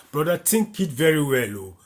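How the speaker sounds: ordinary talk, nothing marked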